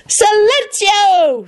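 high, very slow spell cry; boy casting silencing spell; shouted spell. A narrator's reading. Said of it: "shouted Teddy".

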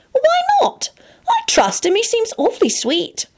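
very high, innocent voice; high-pitched girl objecting; high girlish protest. Acted. Elizabeth naively protests in girl voice.

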